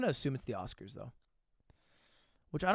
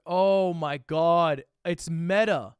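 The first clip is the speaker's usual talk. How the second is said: loud, drawn-out oh my god; real disbelief, slow and much louder; loud stunned realization; stunned surprise; 3 semitones higher, 9 dB louder, much slower than usual. Real, not acted. Realizes the photo shows a streamer.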